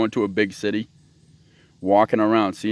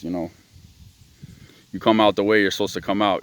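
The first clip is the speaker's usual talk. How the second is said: ordinary talk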